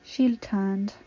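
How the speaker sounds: ordinary delivery, nothing marked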